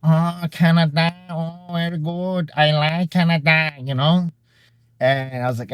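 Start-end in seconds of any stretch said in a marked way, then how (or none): 0.0-3.7 put-on pleased Thai girl. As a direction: approve sweetly, like a local girl. Thai girls pleased he's Canadian.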